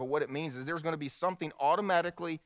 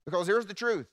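louder, higher preacher's build-up; preaching; 7 semitones higher, 6 dB louder than usual. Acted. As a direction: lean in and preach. Preaching at full force.

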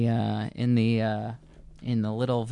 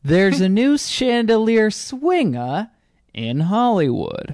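mock showbiz host; 8 semitones higher, 4 dB louder than usual. Mock entertainment news announcer.

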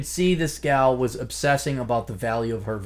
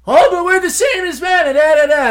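high, loud mocking shriek; mock shrieking woman; 11 dB louder than usual. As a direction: shriek it like a parody.

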